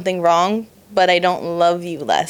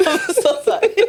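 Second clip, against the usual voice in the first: high, giggly apology; laughing apology; 12 semitones higher than usual. Real, not acted. Co-host saying sorry for the too-soon joke, laughing. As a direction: apologise while cracking up.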